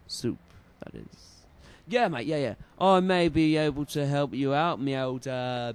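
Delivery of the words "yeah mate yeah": ordinary talk, nothing marked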